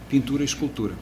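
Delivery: ordinary delivery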